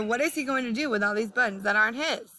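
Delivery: usual speaking voice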